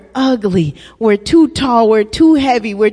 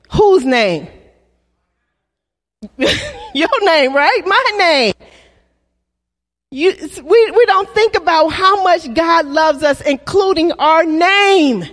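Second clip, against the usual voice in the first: usual speaking voice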